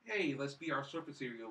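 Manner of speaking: normal talking voice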